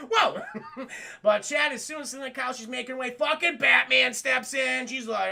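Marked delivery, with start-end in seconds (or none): 0.0-0.9 high real laughing burst; high laughter. Real, not acted. Streamer cracking up at Batman, high laughter.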